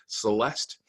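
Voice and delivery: plain reading voice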